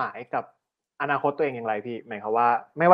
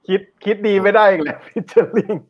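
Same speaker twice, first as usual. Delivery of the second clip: laughing; 5 semitones higher, much slower than usual. Real, not acted. Teasing the guest, laughing.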